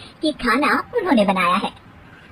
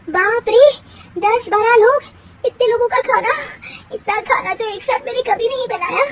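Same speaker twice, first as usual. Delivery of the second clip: panicked dismay; 10 semitones higher than usual. Acted. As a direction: gasp at the huge task. Bahu panics at workload.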